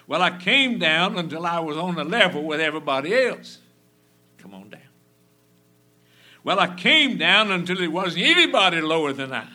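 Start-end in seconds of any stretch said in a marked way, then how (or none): 0.1-3.6 emphatic reply. Retold or imitated. Old writer's replies, loud. 6.4-9.5 emphatic reply. Retold or imitated. Old writer's replies, loud.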